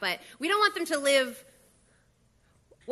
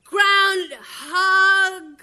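cheering; 8 semitones higher, 9 dB louder, much slower than usual. Acted. Celebratory toast.

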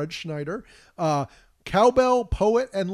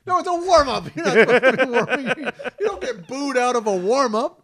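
laughing protest; 7 semitones higher, 7 dB louder than usual. Real, not acted.

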